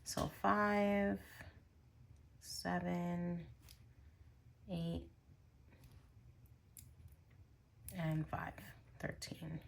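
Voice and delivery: whispered counting, trailing off; counting under breath. Real, not acted. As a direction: count the bills softly to yourself. Counting money quietly to herself.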